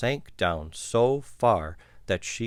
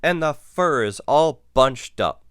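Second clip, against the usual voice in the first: mildly huffy complaint, pitched up and louder; loud, put-out mother bear; huffed; 5 semitones higher, 6 dB louder than usual. A narrator's reading. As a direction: huff about it indignantly. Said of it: "she huffed".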